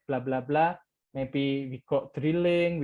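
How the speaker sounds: usual speaking voice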